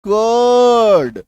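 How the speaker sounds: कर्ड very drawn out as "kuuhd", no R; silent R. Shown as a demonstration. Teacher answering for students, कर्ड long with silent R. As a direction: stretch the vowel in कर्ड, R dropped. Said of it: "आर का sound आपको करना नहीं है".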